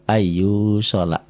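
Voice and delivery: drawn-out "Ayu sholat", long y and long u; two-beat long vowel. Shown as a demonstration. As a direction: recite "Ayu sholat" slowly with "yu" held two beats. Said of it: "dua ketukan karena hijau".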